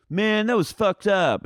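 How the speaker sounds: high, loud, drawn-out mock jeer; mock sniping. Imitating him sniping at people on the reunions, mock sniping. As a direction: heckle from the sidelines, hammed-up and high. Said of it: "sniping at people or being like".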